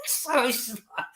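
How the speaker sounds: usual speaking voice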